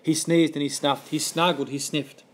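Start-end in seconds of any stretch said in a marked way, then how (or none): none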